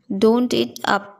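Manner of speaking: slowly, every word laid out; teacher's slow model repeat; slow careful repeat. Shown as a demonstration. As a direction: drag it out for the learner. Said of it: "teacher repeats phrase for learners".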